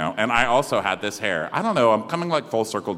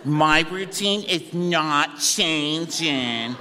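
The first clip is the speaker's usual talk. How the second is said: put-on higher, slow whiny teen; slow teen whine; 7 semitones higher, much slower than usual. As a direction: drag it out like a sulky teen. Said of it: "she is like".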